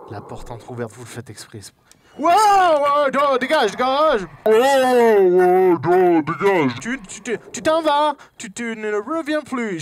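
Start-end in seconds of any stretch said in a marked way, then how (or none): 2.2-6.8 high, very loud panicked yell; startled shouting. Real, not acted. Sursaute, repousse le monstre du jeu. 6.8-9.8 flustered French with an English accent; high, stammered order, slipping into an English accent. Real, not acted. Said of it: "Je prends un accent anglais".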